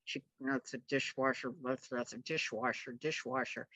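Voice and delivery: low soft mutter; husband's low mutter. Retold or imitated. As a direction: mutter the secret aside. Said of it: "he starts going".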